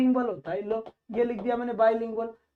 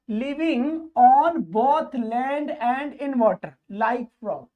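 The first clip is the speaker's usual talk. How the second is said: slow reading; much slower than usual. Shown as a demonstration. Teacher reads phrase slowly.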